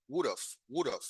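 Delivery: "would have" run together as "would'a"; American reduced would have. Shown as a demonstration. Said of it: "sino would have". ("would have" pronunciado como "wuda")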